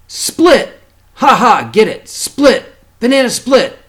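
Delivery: punchline laugh. A narrator's reading. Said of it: "uncle delivers the punchline".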